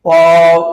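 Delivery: loud, sustained model অব; correct pronunciation. Shown as a demonstration. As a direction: stretch the vowel of অব into a v. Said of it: "correct pronunciation".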